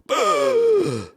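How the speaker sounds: long, high gasp for air; imitated gasp. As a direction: imitate a sleeper suddenly sucking in breath. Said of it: "And I go".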